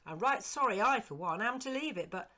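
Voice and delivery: high, loud, eager servant's voice; eager old servant. Acted. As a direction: pitch up as the startled, fond old retainer. Servant William's voice.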